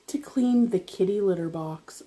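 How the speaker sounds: ordinary talk, nothing marked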